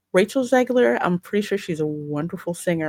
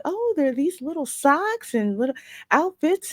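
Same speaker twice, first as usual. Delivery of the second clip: higher-pitched mock wonder; 8 semitones higher than usual. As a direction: lightly imitate her discovering little things. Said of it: "when she walks in and she's noticing".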